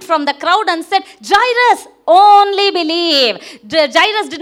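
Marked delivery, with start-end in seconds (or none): 1.3-3.6 loud, high, drawn-out exhortation; commanding call. Acted. Jesus calls to Jairus on hearing the news, commanding call. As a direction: call it out with slow weight on "only believe".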